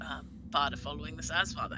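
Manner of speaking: ordinary delivery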